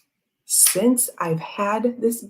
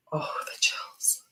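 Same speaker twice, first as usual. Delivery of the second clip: breathy whispered aside; 11 dB quieter, slower than usual. Real, not acted. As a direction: hush it, almost to yourself. Recalling moving moment, whispered.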